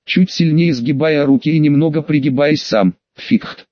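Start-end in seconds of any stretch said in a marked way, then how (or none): 3.1-3.6 voiceless otherworldly barked command; eldritch horror barking. Acted. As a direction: rasp out one unearthly command. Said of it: "рявкнул потусторонний голос".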